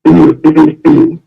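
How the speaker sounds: a deliberate, exaggerated presidential throat clearing; Charles Taylor throat clearing. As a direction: clear the throat grandly, like a president about to speak. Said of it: "Clear, clear your throat like former President Charles Kange Taylor".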